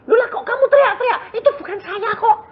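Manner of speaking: very high, fast, laughing demon voice; the demon mocks and denies it. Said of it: "dia ngomong sendiri". (suara setan tinggi dan cepat sambil tertawa)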